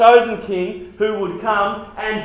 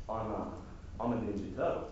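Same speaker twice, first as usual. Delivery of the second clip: much softer, lower, dead serious; deadpan weird kid; 10 semitones lower, 16 dB quieter, faster than usual. Acted. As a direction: say it flatly like an odd kid. Weird kid claims Ninja Turtle.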